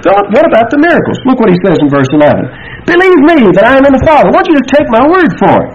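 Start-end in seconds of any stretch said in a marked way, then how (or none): 2.9-5.7 urgent plea. Acted. Pleading paraphrase of Jesus.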